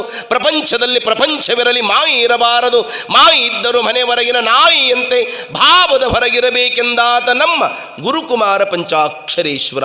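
measured, sustained declamation; chant-like recital of sacred verse; verse recitation. Acted. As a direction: recite each line evenly and firmly to the crowd.

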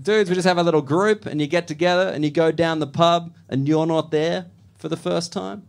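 normal talking voice

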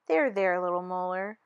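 calm the upset little molar; teacher soothing a hurt molar. Acted.

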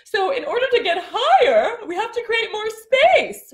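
high, stretched yawny voice; swooping high, open-throated speech. Shown as a demonstration. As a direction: talk with lots of space at the back. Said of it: "See how open I am?".

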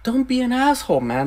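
a hint of a scolding mother; mock mom warning. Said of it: "And the mom's like".

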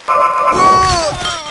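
high, loud oh; startled cry. Real, not acted. Player caught out in the game, startled high cry.

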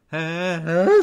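loud, high build-up to a sneeze; explosive theatrical sneeze; huge sneeze. A narrator's reading. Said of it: "Trautenberg kýchl tak strašlivě".

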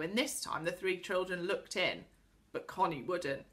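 plain reading voice

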